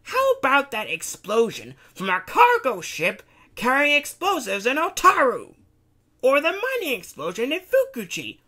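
high, loud accusing rant; angry shout. Acted. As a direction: build the list into a shouting accusation. Takashi listing Daichi's disasters.

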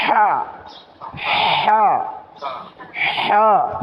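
হা with a long, tight rasping breath from the throat; pressed-throat H. Shown as a demonstration. Teacher models the heavy Arabic letter, pressed-throat হা. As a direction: squeeze the throat on the H of হা. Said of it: "চেপে উচ্চারণ করতে হবে".

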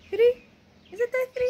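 high, unsure rising question. Real, not acted. Vlogger checking the day with a companion, high questioning. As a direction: pitch it way up as you double-check.

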